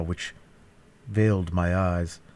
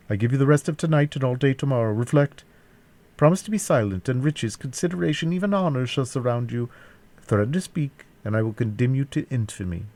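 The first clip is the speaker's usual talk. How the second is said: higher-pitched villain setting terms; captor giving ultimatum; 6 semitones higher than usual. A narrator's reading. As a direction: press the prisoner with promise and threat, pitched up.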